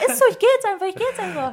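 high whiny huff; mock sulking. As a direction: pretend to storm off. (gespielt eingeschnappt)

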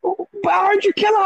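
put-on high, whimpering child voice; crying little boy. Father imitating his crying son, high and whimpering. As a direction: play the upset little son. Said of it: "He said".

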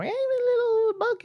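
very high squeaky bleat; imitated Pokémon cry. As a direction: squeak it out like a tiny bug. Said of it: "Surskit is a little more".

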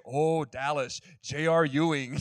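loud mock-Scottish exclamation of recognition; imitated Scottish accent. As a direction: imitate a delighted Scot's accent. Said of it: "in their Scottish accent".

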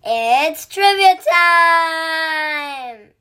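slow, drawn-out excited shout; excited announcement. Acted. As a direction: shout it like a game-show announcer. Announcing the trivia segment.

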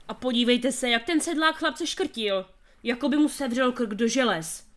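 ordinary delivery

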